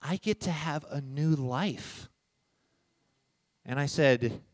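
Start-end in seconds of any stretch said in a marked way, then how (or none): 0.0-2.1 imitated daughter. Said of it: "She goes".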